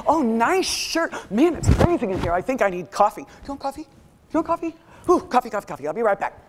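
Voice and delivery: scattered, excited chatter; frazzled latecomer. Acted. Late colleague running into a meeting, frazzled. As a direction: burst in babbling, jumping between thoughts.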